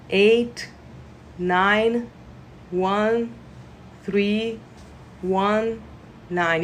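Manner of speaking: numbers read one at a time, long pauses; slow reading. Shown as a demonstration. Healer reading out pain management numbers, slowly. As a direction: read each digit slowly and separately. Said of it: "I'm gonna read it slowly".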